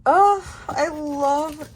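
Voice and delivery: high, loud, slow gush. Real, not acted. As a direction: swoon over it. Gushing over binder.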